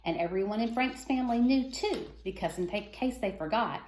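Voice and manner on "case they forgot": plain reading voice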